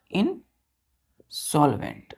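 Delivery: Insolvent said very slowly in two parts, "in" and "solvent"; broken into parts. Shown as a demonstration. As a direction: split insolvent with a long pause after the first syllable. Teacher breaks word into parts.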